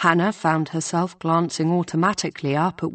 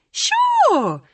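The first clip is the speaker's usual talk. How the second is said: much higher, bright cheerful sure; 4 dB louder than usual. A narrator's reading. Shop colleague Robin agreeing to cover, cheerful. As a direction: toss it off cheerfully. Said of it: "Robin says cheerfully".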